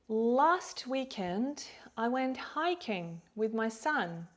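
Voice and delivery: "Last weekend" drawn out slowly, then "hiking" and "son" stressed; emphatic rhythm led by a long, strong "Last"; stress on key words. Shown as a demonstration. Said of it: "Stress falls on last weekend".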